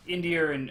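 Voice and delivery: "India and" with an R sound linking the words. Shown as a demonstration. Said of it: "R linking".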